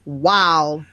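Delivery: usual speaking voice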